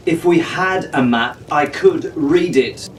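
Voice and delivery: usual speaking voice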